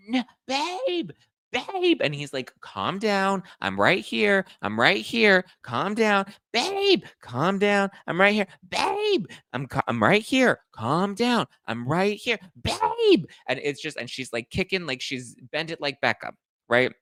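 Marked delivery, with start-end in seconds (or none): 0.1-2.0 frantic mock wail. Woman kicking in police car. 2.6-13.3 high repeated calm-down with shrieked babes; calm her while she keeps shrieking; mock soothing over squeals. Boyfriend soothing, woman interrupting.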